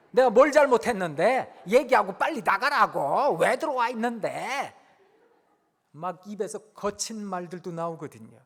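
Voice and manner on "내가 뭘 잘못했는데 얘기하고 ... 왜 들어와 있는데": put-on loud, furious teenager voice; imitating a kid's fit; angry teen outburst. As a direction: snap at the parent to get out. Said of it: "아이들이 그때부터 발작을 합니다".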